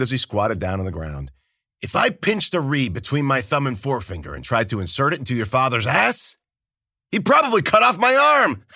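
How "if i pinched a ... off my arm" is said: loud, high bawdy joking; a tipsy old man ribbing his friend; booming high-pitched jester voice; jolly Kabata. A narrator's reading.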